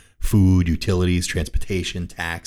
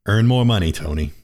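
loud, deadpan wisecrack; teasing joke; 2 semitones higher, 5 dB louder than usual. Pretend. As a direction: punch it out as a cheeky joke. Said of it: "I'm just kidding with you".

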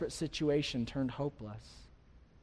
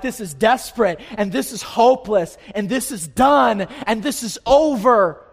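loud, high acted outcry, building; preacher's hammed-up cry of despair; worked-up despair; 7 semitones higher, 13 dB louder than usual. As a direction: cry out each verdict as a hopeless listener would. Voicing listener's despairing outcry.

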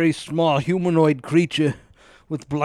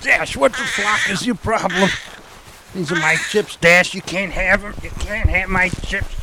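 raised, irritated scolding; angry scolding; 4 semitones higher than usual. Acted. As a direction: tell the cat off. Scolding cat over chips.

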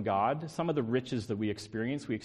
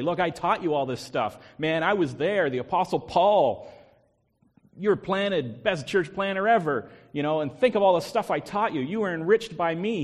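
boastful Paul; 5 semitones higher, 6 dB louder than usual. Pretend. Preacher voicing a boastful Paul.

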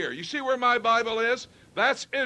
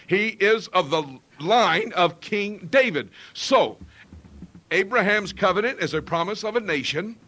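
ordinary delivery

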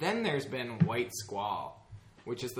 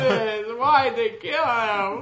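high, loud hammed-up child wailing; mock kid crying; 11 semitones higher, 11 dB louder than usual. Imitating himself as a kid forcing tears, hammed-up wail. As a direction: ham up a six-year-old faking tears. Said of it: "I probably didn't sound like that".

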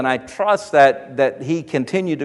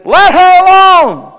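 much higher, louder and slow; commanding Jesus; 13 semitones higher, 9 dB louder, slower than usual. Acted. Jesus telling the disciples to leave her, commanding. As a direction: proclaim it as Jesus defending her.

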